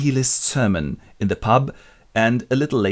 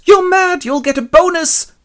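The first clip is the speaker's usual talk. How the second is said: much higher, louder gleeful squeal; ecstatic shriek; 10 dB louder than usual. Retold or imitated. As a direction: squeal with mad joy. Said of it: "jumps in orgasmic joy".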